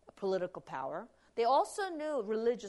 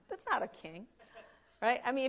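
ordinary delivery

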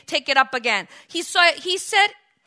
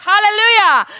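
loud, higher hallelujah; joyful shout; 6 semitones higher, 10 dB louder than usual. Real, not acted. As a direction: lift the room with a loud cry. Preacher's exultant cry.

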